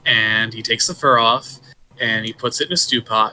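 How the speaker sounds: ordinary talk, nothing marked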